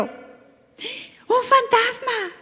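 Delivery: high, very slow drawn-out frightened wail; terrified cry. Retold or imitated. Disciples crying out at the figure on the sea, high drawn-out wail. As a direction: wail it as if seeing a ghost.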